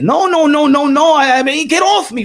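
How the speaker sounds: loud pushing-away protest; mock shout. Tank Davis shoving off Floyd, loud protest. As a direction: shove him off with your voice.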